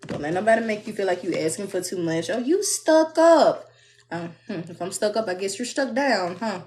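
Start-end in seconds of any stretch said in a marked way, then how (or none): 2.3-3.7 high, loud, slow mock sneer; hammed-up high sneer; mock sneering critic. Quoting a critic mockingly.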